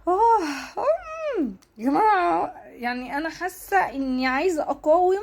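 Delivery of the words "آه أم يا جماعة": high choked cry breaking through; sob. Real, not acted. Vlogger's voice cracking into a high sob before viewers. Breaking down while resisting tears.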